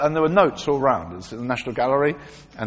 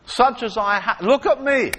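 lightly acted bold apostle, higher voice; Simon; 8 semitones higher, 5 dB louder than usual. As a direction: command attention as you offer healing. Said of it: "this is Simon".

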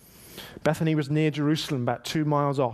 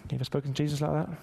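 usual speaking voice

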